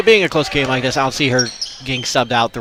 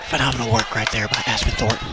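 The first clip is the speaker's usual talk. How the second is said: golf commentator; 4 semitones lower, 8 dB quieter than usual. Acted. Continuing golf announcer joke.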